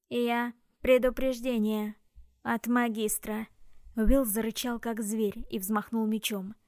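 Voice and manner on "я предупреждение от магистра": slow, grinding machine voice; metallic robot voice. Acted. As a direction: repeat it like a broken machine. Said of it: "оно снова повторило".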